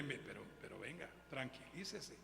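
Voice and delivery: calm a frightened woman gently; affectionate reassurance. Retold or imitated. Pastor soothing the scared woman, soft and tender. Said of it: "Con cariño le dije".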